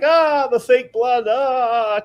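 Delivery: high, slow hammed-up cry of fake pain; stagey yelp over fake blood; mock pain cry. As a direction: pretend the saw just got you. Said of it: "and be like".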